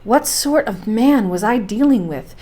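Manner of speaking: plain reading voice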